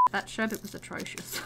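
whispered disgust. Real, not acted.

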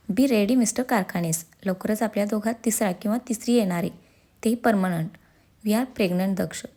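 ordinary delivery, nothing marked

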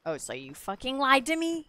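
loud, high accusing yell; mock outrage. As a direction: play up the betrayal.

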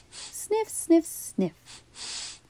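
quick light sniffs, breathy and high; sniff, sniff, like a curious hamster; sniffing sounds. A narrator's reading. Hamster smelling the car, soft sniffing noises.

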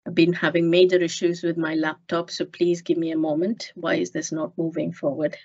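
ordinary talk, nothing marked

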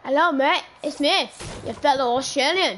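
loud mock Australian greeting, lightly put on; hammy Aussie "mate" voice, called out; Australian accent. Chat asked for Australian voice, loud mock Aussie. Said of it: "Do your, do your Australian joint voice".

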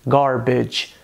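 "garbage" with an American R; American accent. Shown as a demonstration. Teacher giving the American version, American accent. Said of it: "no inglês americano".

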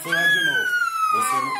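high, loud scared cry; real fear, voice near breaking; frightened protest. Real, not acted. Fearful protest about needle.

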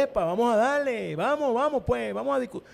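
voz más aguda y fuerte, debate encendido; arenga animada. Acted. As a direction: animar a entrar al debate, tono alto y enérgico. Imita un debate sabroso y animado. (arenga animada, bien alta)

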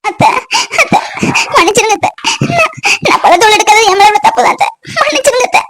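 high, tearful pleading; weeping apology; crying. Acted. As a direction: beg forgiveness through sobs. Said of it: "அழாத பவித்ரா, அழாதம்மா".

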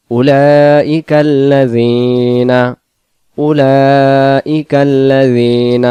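slow melodic tajweed chant, long held vowels; chanted Quranic recitation. Shown as a demonstration. Teacher recites the verse.

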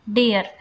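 डेयर gliding from ए into अ; air sound in dare. Shown as a demonstration. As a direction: slide ए to अ in डेयर. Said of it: "ए और अ आ रहा है साथ में". (डेयर में ए से अ)

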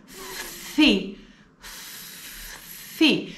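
Φθι whispered, φ then θ; unvoiced Φθι drill; breathed syllable. Shown as a demonstration. Teacher breathes out the φθ syllable. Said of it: "Φι πλας θήτα".

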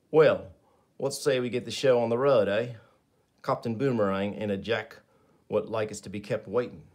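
a lightly voiced Australian accent; a touch of Aussie swagger. Captain Boomerang talks to the team in an Australian accent. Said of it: "Pardon me for my, uh, Australian accent".

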